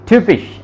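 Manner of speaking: ट्यूपिश with a rounded ü vowel; German typisch. Shown as a demonstration. Tutor showing German typisch to a Hindi speaker. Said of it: "इसका sound निकल रहा है ट्यूपिश".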